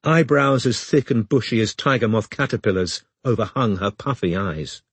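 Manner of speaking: ordinary delivery, nothing marked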